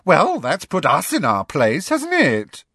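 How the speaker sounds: high, loud mocking creature; sarcastic gargoyle, rising high; high-pitched gargoyle. A narrator's reading. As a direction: make a loud, high show of being put out. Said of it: "in a high-pitched voice".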